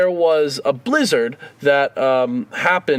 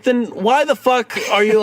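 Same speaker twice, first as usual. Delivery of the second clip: exasperated; 6 semitones higher than usual. Retold or imitated. Retelling frustration at airline clerk.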